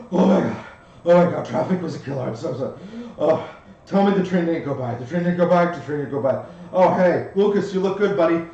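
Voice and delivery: high, loud, flustered babble; frantic latecomer. Acted. Zed rushing up fearing the train has gone, frantic and loud. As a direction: burst in late, gushing apologies.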